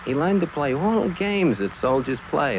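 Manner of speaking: ordinary delivery, nothing marked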